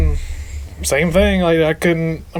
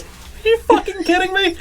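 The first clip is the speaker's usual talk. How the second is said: exasperated outburst; 14 semitones higher than usual. Retold or imitated. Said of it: "all you hear is".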